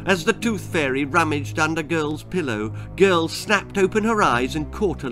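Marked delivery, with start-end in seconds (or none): none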